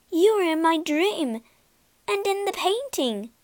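high, amazed exclamation; excited cry. A narrator's reading. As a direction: burst out in wonder. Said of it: "cried Beauty".

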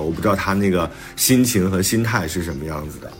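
usual speaking voice

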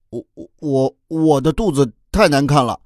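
put-on stammering, high-pitched child voice; embarrassed child. A narrator's reading. Child ashamed of his ugly belly, embarrassed stammer. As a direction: stumble over the first word, pitched high.

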